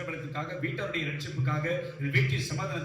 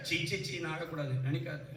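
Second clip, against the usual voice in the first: acted embarrassed self-talk; 7 dB quieter than usual. Preacher voicing someone telling themself not to cry, hushed mock embarrassment. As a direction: drop right down, as if scolding yourself privately.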